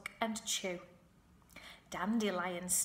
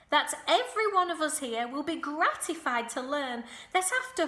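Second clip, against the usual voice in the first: high, loud formal spider voice; proud announcement, pitched up; Charlotte's voice; 5 semitones higher, 7 dB louder than usual. A narrator's reading. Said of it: "made the announcement".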